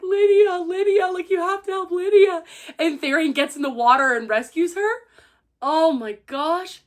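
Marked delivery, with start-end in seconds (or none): 0.0-2.4 desperate pleading. Retold or imitated. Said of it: "He's like".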